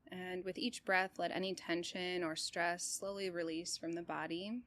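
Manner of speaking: calm, even meditation guide with long pauses; unhurried body-scan voice, settled and steady; guided meditation. Real, not acted. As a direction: guide them gently through breath and body, leaving space between phrases.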